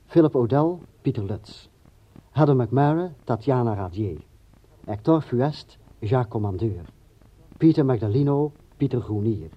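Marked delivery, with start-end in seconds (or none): none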